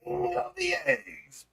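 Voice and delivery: very high, slow, drawn-out royal call; grand royal proclamation. Pretend. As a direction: announce yourself as king of the eggs. Hailing himself as egg king.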